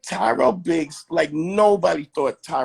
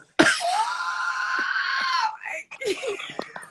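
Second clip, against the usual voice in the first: long, very high squeal of laughter; real helpless shriek of laughing; 4 dB quieter than usual. Real, not acted. Listener cracking up at the crying imitation, shrieking laughter. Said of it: "freeze frame that laughter".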